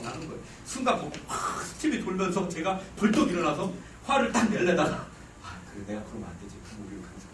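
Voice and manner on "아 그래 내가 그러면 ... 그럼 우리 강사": very low, hushed self-talk; quiet, suppressed inner voice; self-restraint. Retold or imitated. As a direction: talk yourself down under your breath. Retells calming himself down before exploding.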